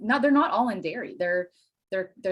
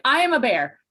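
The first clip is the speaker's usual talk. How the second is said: louder, higher declaration; proud translation; 6 semitones higher, 7 dB louder than usual. Retold or imitated. Child explaining to mom: loud proud translation. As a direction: proclaim it proudly.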